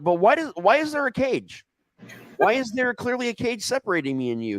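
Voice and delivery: rising disbelief at the cage; baffled alarm. Retold or imitated. Telling friends about spotting the cage, high and incredulous.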